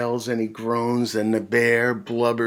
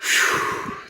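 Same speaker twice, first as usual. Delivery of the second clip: weary sigh. Acted. Sigh before refrain.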